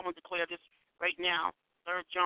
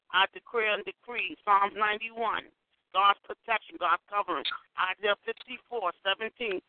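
praying; 3 semitones higher, 4 dB louder than usual. Acted. Leads a decree prayer.

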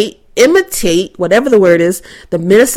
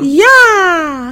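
high put-on Minnesotan yeah; Minnesotan accent; 7 semitones higher than usual. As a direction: keep the Minnesota lilt going. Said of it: "like the little character from Cooking Mama".

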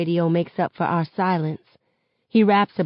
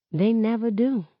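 ordinary delivery, nothing marked

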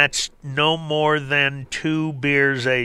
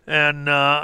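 ordinary talk, nothing marked

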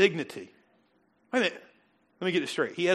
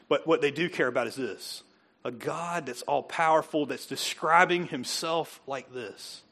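ordinary talk, nothing marked

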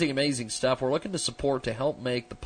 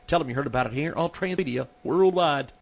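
ordinary talk

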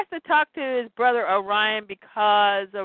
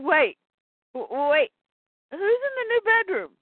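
panicked stammer; 8 semitones higher than usual. Acted. Scott panics in acted quote.

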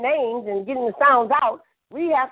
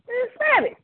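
high, incredulous outburst; mock reaction; 10 semitones higher, faster than usual. Retelling how he reacted to callers on a show, high and incredulous. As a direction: scoff at a caller faking a voice. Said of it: "I said".

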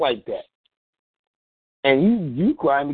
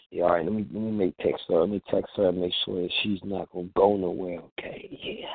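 low, softer, dropping the tearful act; sly aside; 7 semitones lower, 7 dB quieter than usual. Acted. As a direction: act a schemer talking quietly to himself. Man drops fake tears, plots texting.

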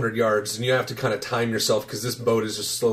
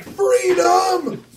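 high, long-held shout; triumphant mock cry. Rower in the zone on seeing the boat, long triumphant shout. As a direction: draw it out like a battle cry. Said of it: "seeing this boat is like freedom".

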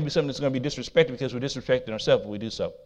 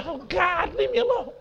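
high, whiny mock plea. Preacher imitating himself begging the little dogs, high whine. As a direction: pretend to beg the dogs to leave you. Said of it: "I say".

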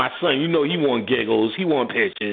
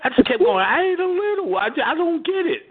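imitating wife; 14 semitones higher than usual. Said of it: "She said".

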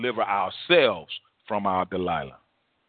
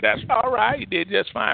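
higher-pitched mock reassurance; approving flesh; 7 semitones higher than usual. Flesh to the man felled by overeating, reassuring. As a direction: voice the flesh excusing it all, pitched up. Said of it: "Flesh said".

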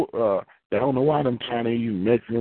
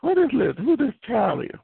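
surprised; 12 semitones higher than usual. Retold or imitated. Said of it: "I was like".

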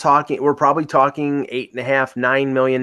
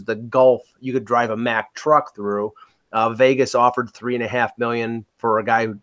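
usual speaking voice

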